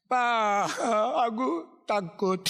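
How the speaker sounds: acted sobbing child; tearful fear. Child crying, afraid to tell father.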